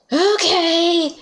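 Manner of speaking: loud, bright, high exclamation; excited okay. Real, not acted. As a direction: pump yourself up. Psyching up before the quest.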